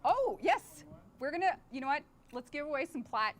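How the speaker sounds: high, loud burst of excitement; excited. Real, not acted. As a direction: jump in with sudden delight. Sudden giveaway idea.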